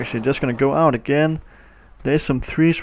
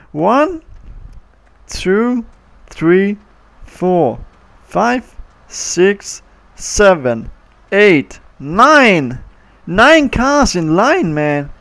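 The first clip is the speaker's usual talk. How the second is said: higher, louder counting up; excited counting; 5 semitones higher, 5 dB louder, much slower than usual. Real, not acted. Kid counting cars stuck in line, excited. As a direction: count them off with rising glee.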